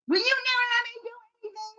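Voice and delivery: put-on high, fast squeal of a child protesting; whining kid. Kid baiting a parent after a no, whining in a high, rushed voice.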